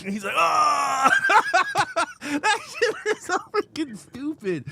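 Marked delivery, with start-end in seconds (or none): 0.4-1.1 high, loud mock yell; hammed-up "ah" scream; imitated cry. Imitating Ash's cry in film. 1.2-4.6 high, slow, laughter-choked words; helpless laughing delivery; real laughing. Real, not acted. Cracking up at quoted scene.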